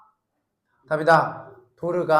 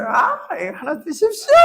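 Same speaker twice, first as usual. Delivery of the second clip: polite offer; 7 semitones higher than usual. Pretend. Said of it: "딱 이렇게 하는 거야".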